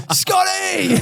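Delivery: excited high call of a name; retold laughing shout. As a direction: yell out to a star across the stands, laughing. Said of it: "I go".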